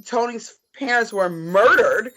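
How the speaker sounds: usual speaking voice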